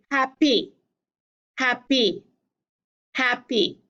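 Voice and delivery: "happy" with the beat moved onto PY; stress on second syllable. Shown as a demonstration. Teacher showing an iamb to the class, stressed on the second syllable of "happy". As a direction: say "happy" as hap-PEE, two separate beats. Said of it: "Put the accent, the stress, the beat on the second syllable".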